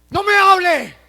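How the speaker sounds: angry outburst, screamed at someone; furious shout. Acted. Angry believer shouting at another. Angry person refusing to talk.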